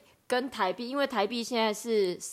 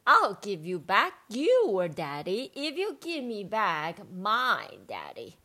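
slow bargaining; slower than usual. Acted. Bargaining with fish.

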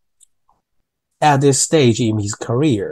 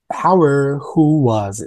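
"Howard who was" slow, slightly higher; slow demo; 3 semitones higher, slower than usual. Shown as a demonstration. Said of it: "所以注意一下". (放慢念 Howard who was)

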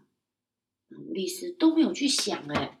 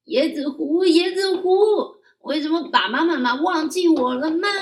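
shouting; 9 semitones higher, 10 dB louder than usual. Acted. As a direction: call out across the lake. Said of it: "对着椰子湖里面大喊". (孩子朝着椰子湖大喊)

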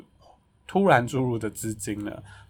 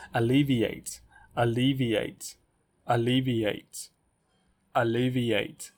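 slow careful repetition; much slower than usual. Shown as a demonstration. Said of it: "我每次念这个字都有障碍".

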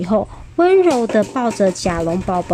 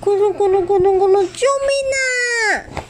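crying for help; 11 semitones higher than usual. Acted. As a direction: yell for help while falling. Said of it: "突然大叫".

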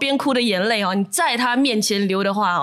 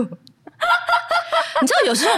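laughing advice; 13 semitones higher, much slower than usual. Real, not acted.

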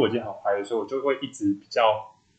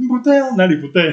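higher, louder, laughing through the words; laughing confusion; 8 semitones higher, 10 dB louder than usual. Retold or imitated. Laughing at her own confusion while telling friend. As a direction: giggle at how silly you were. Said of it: "然后我说".

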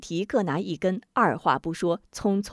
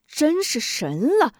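amazed; 7 semitones higher, slower than usual. Acted. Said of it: "大吃一惊".

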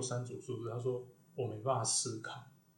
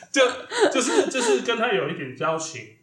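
higher and louder, still giggling; laughing explanation; 10 semitones higher, 10 dB louder than usual. Real, not acted. Still laughing, explaining the client is just an acquaintance. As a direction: backpedal while still laughing.

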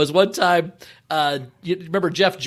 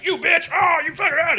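mock brawl yell; 12 semitones higher than usual. Big woman yelling at the small one in a bar fight, mock yell. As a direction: imitate a woman screaming mid-fight. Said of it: "the big one's like".

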